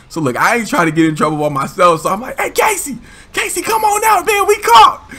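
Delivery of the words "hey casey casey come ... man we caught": high, loud shout to a hidden friend; calling out. Retold or imitated. Kid shouting to Casey hiding in a doorway, loud high call. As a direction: yell across the lot for Casey.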